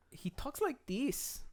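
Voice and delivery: high, soft, slow mock German accent; slow, exaggerated German-accented demo; bad German accent. Shown as a demonstration. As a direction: badly fake a thick German accent. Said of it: "that was a bad German accent".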